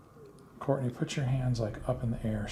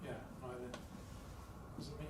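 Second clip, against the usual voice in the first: whispering; 19 dB quieter than usual. Real, not acted. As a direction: barely breathe the words. Hushed denial in the dark.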